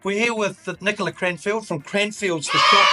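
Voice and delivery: ordinary delivery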